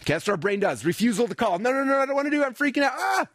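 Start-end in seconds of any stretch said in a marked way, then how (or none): 1.6-3.3 high, frantic mock panic ending in a yelp. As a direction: play the brain freaking out, way up high. Brain voiced panicking at call.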